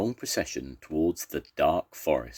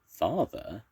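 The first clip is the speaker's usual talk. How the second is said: higher, hesitant question; slowly; 6 semitones higher than usual. A narrator's reading. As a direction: let the word out slowly. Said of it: "said Basil slowly".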